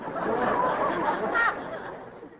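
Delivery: sheepish high-pitched laugh; high nervous giggle. Acted. Aubrey to Amy after confessing, high sheepish laugh. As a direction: laugh it off in embarrassment.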